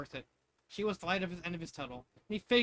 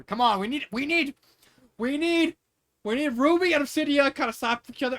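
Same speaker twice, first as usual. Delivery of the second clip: loud, high, rising insistence; pleading for the fight, louder and louder; excited demand; 9 semitones higher, 11 dB louder than usual. Real, not acted. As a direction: keep pushing higher each time. Reader begs for a slap fight.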